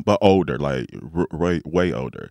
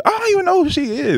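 high, fast, hammed-up retelling of his own words; mock clueless reply; 5 dB louder, faster than usual. Telling friends how his reply backfired, mock clueless. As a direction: overplay how clueless he sounded. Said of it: "I said".

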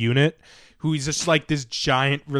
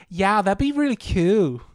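put-on breathy girly voice; 6 semitones higher, slower than usual. Girl quoted, high, slow.

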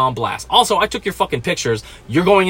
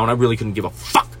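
ordinary talk

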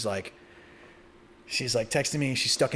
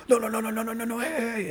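much higher frantic no's; frantic calling out; 10 semitones higher, faster than usual. Retold or imitated. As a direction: cry out to stop them touching the bag. Running down to stop guards.

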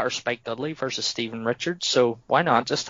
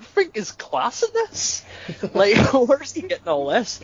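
amused disbelief; 10 semitones higher than usual. Retold or imitated. Host recalls his own reaction to co-hosts, high and laughing. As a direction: squeal with delight at the absurd. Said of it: "I'm like".

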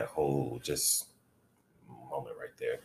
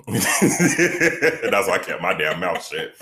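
laughing loudly, slowed; 5 semitones higher, 13 dB louder, slower than usual. Real, not acted. Cohost laughing at the joke, loud and slow. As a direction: laugh through every word.

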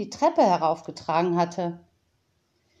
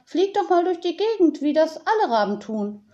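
Tiziana quoting herself; 9 semitones higher than usual. Retold or imitated. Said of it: "dann sage ich zu ihm".

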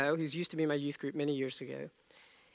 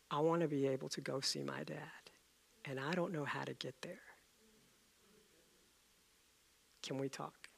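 soft retold plea; 10 dB quieter than usual. Young man's plea retold softly.

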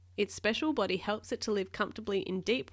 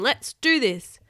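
loud, raised-pitch battle cry; rally the crew at full voice; commanding, pumped-up shout; captain's rallying shout; 4 semitones higher, 10 dB louder, slower than usual. A narrator's reading. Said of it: "shouted the captain".

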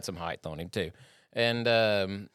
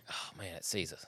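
low, very soft dismayed aside; hushed dread; 4 semitones lower, 14 dB quieter than usual. Retold or imitated. Vet peeking under door at loose monkey, hushed mock dread. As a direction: drop to a near-murmur, as if spotted.

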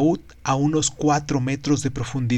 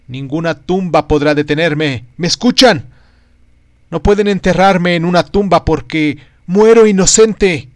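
defiant shout; 4 semitones higher, 8 dB louder than usual. Acted. Condemned man shouts from gallows.

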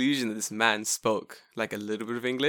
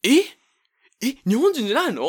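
high, louder baffled question; a baffled old man asking twice; confused surprise; 13 semitones higher, 8 dB louder than usual. Retold or imitated. Confused Japanese man questions him.

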